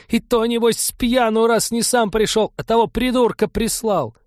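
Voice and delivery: high shrieking rage; furious screaming. Acted. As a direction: scream it at him, beside yourself. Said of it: "разъярилась ещё больше".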